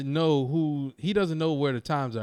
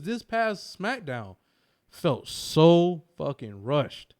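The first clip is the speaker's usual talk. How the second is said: slow emphatic complaint; slow and drawn out; much slower than usual. Real, not acted. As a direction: draw every word out.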